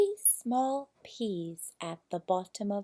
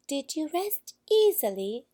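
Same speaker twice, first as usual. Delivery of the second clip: clever queen; 8 semitones higher, 4 dB louder than usual. A narrator's reading. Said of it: "asked the clever queen".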